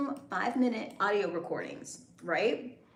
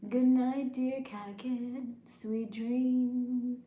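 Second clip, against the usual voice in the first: slow, tender goodnight; lullaby voice; 4 semitones higher, much slower than usual. Shown as a demonstration. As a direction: croon it like a lullaby. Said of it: "Kakin wanted a lullaby".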